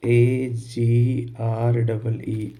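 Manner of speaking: letters spelled one by one, very slowly; spelling the word for students. Shown as a demonstration. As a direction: dictate each letter slowly. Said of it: "जैसे मैंने लिखा agree".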